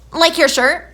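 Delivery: high, loud sassy question; snotty kid. Retold or imitated. Teller imitating a girl sassing back about the shirt, high and loud. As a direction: mimic a bratty nine-year-old's comeback.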